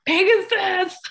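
blurts the name out high and loud; excited recognition. Real, not acted. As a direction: shout it like you just remembered.

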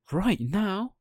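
high rising astonished question; can't believe it; amazed question. Acted. Pinocchio amazed at the coachman's offer, high rising.

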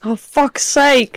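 high, drawn-out groan of dismay; exasperated. Real, not acted. Contestant hearing it's a Formula E quiz, exasperated. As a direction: moan it slowly in dread.

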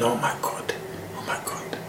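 breathless whispered panic; hushed frightened inner voice; panicked whisper. Retold or imitated. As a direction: whisper it to yourself in dread. Panicked thought on the bus.

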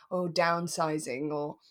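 ordinary talk

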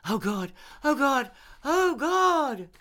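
very high, very loud climaxing cries; ear-splitting cries. Acted. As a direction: wail it out, rising to a peak. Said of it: "reached an ear-splitting volume".

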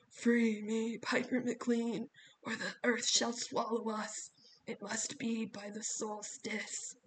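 put-on slow distant older woman; possessed echoing voice. A narrator's reading. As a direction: intone slowly, as from far away. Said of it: "an older woman speaking from somewhere far away, down a long, echoing pipe".

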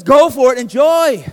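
loud cheerful go-ahead; God's cheerful blessing. Acted. God cheers on married couples, loud and cheerful. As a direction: shout the permission with a grin.

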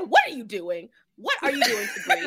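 loud, rising retold confrontation; hammed-up loud challenge; mock outraged demand. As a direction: burst in demanding answers, half laughing. Retells a character bursting in, confronting.

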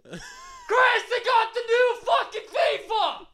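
high, loud, drawn-out holler; excited scream across store. Acted. Calling a friend from the video games to clothes, high excited scream. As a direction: yell the news slowly across the whole store. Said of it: "I just scream for you".

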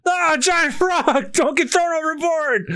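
high, slow, alarmed cries; panicked crew voice. Acted. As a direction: yell in mock panic at the frog. Voicing panicked crew in manga.

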